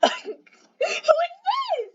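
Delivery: very high, shocked shriek; shrieking who. Acted. Cat shrieks at the gray stranger.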